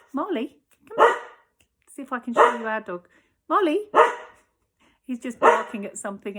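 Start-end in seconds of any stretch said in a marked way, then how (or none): 0.1-3.9 calling the dog. Real, not acted.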